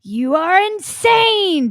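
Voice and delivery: mock screaming accusation; imitating a screaming guru. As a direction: ham up a guru berating a woman. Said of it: "screaming at this woman".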